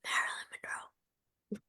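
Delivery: whispery, breathy starlet voice; soft, airy whisper of a screen siren; Marilyn Monroe. Retold or imitated. Coach imitating her old weak voice, whispery starlet impression. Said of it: "I stopped sounding like Marilyn Monroe".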